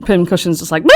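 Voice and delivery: ordinary talk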